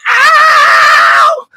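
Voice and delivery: very high falsetto "ow"; soul singer's squeal; high sung yelp. Acted. Host hamming up the song, high yelp.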